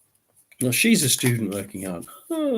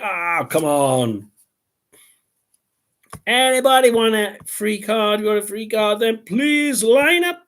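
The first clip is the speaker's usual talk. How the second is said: calling out; 8 semitones higher, 4 dB louder than usual. Retold or imitated. Calling for viewers to come.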